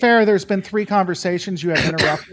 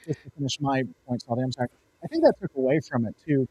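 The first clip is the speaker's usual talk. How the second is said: ordinary talk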